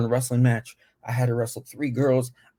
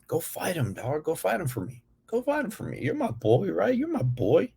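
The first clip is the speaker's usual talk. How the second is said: high, pushy egging-on voice; imitating the friend, higher and pleading; 7 semitones higher than usual. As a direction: egg him on to fight, pitched up. Friend urging Bird to fight.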